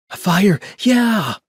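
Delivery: very high, loud happy cheer; child cheering. A narrator's reading. Rosie cheering about the campfire, very high and loud. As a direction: cheer like a thrilled little kid. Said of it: "Rosie cheered".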